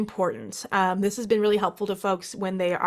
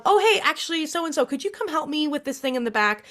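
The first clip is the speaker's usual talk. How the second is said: casual breezy excuse; 6 semitones higher than usual. Pretend.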